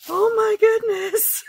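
slow, loud, very high, laughing; scandalized gasp. Retold or imitated. Cashier hearing shirts become junk journals, laughing high shock. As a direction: ham up a horrified clerk clutching her pearls. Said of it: "she about had a fit".

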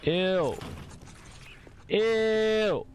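rising squeaky "ew"; disgusted. Pretend. Disgust rising each time.

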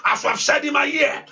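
preaching at full force, chanting and calling out; fervent prayer. Acted. Pastor urging his congregation to pray aloud, preaching at full force.

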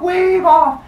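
high, loud announcer-like call; hyped-up challenge. Acted. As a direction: call out the contest. Crowd challenge in told story.

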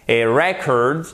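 "record" with REC stressed; stress on first syllable. Shown as a demonstration. Teacher showing learners the noun for a disc, first-syllable stress on "record". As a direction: hit the first syllable of "record", second one weak. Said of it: "l'accent tonique est sur la première syllabe".

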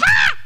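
sudden high mock scream of fright; a friend startled by a fake spider, high shriek; retold scream. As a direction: yelp like she just saw a spider. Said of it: "And Ann goes".

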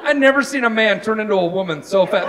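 normal talking voice

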